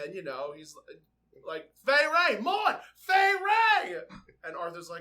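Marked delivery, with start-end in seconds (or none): 1.9-4.0 "Fay Wray" cried out much higher and louder, the last one drawn out slowly; star-struck shout of "Fay Wray!"; excited repetition. Retold or imitated. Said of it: "different octaves and different volumes".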